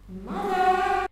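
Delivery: high, full-volume cut-off curse; hammed-up shriek of agony; pained scream. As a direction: scream it out in sudden pain. Acted pained cry in story.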